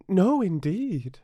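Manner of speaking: put-on a polite young girl answering; little girl Alice. Alice's voice in the story.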